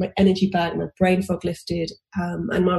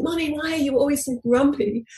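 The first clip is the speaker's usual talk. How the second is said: little daughter asking; 8 semitones higher than usual. Retold or imitated. Daughter questions grumpy mum.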